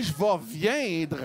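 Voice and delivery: very slow, drawn-out warning. Retold or imitated. Man warning his partner, very slow. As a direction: stretch every syllable. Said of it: "j'ai dit".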